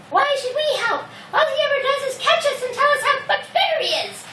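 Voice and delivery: high-pitched mouse complaint; high, squeaky objection from the mice; grumbling mice. Acted. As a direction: protest in a high little mouse voice. Other mice answer in protest.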